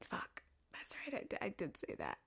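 hushed guilty whisper; whispered dismay. Retold or imitated. Caught by the roommate remembering her words, guilty whisper. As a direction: breathe it out like you got caught. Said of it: "I was like".

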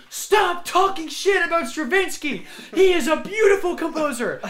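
high, loud mock yell; shouting in defense of Stravinsky; retold scream. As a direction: yell it in shrill outrage at the teacher. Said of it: "I started screaming".

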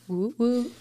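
normal talking voice

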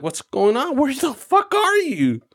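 high, disbelieving boss yelling; a boss who can't believe his driver is lost; exasperated yell. Retold or imitated.

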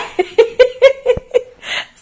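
high wheezing real laugh, almost crying; laugh to tears. Real, not acted. Laughing at mom's answer until it sounds almost like crying.

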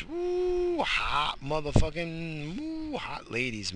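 high woo then slow drawl; leering excited holler; hooting woo. Pretend. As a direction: hoot it, then stretch it out. Hooting about women.

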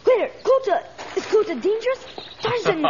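put-on a small boy suddenly scared of what's underfoot; startled fear. Boy startled by hidden tortoise.

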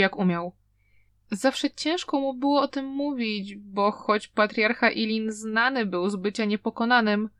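plain reading voice

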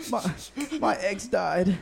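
mock sobbing girlfriend; imitated crying. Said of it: "she's crying, she's like".

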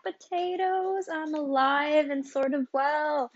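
high, slow, drawn-out hello; sing-song greeting. Acted. Host greets listeners in a high sing-song voice. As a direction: croon the return like a big entrance.

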